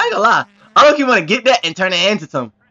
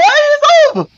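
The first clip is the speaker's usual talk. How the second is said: high excited cry; 4 dB louder, slower than usual. Real, not acted. Car tilting on a corner.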